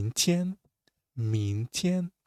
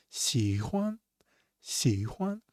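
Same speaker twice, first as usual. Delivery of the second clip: usual speaking voice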